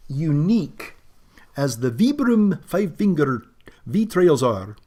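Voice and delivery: playful Scottish lad impression. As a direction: put on a Scottish lad's accent. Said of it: "That's my Scottish lad, lad voice".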